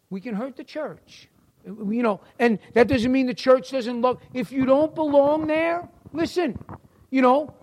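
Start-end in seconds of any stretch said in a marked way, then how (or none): 0.1-1.3 hushed. Acted. Repeated warning.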